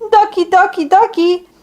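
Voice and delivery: high coaxing call to the ducks; sing-song call of a kind giant. Acted. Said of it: "called us".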